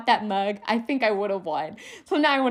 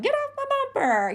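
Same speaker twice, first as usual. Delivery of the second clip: high, mock-snippy sticker line; snarky sticker voice. Mocking rude bumper stickers, high snarky voice. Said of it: "most stickers are snarky and sarcastic".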